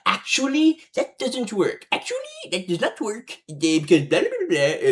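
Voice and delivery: high-pitched, whiny mock voice; high mocking voice of an SCP writer dodging a plot hole. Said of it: "they will write in just some bullshit cop-out".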